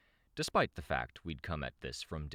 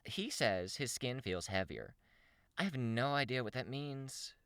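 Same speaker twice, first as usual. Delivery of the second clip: higher-pitched character voice; female character; 9 semitones higher than usual. Acted. Shamrock answering about Gregor's symptoms, raised character voice. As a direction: lift the pitch for her dialogue.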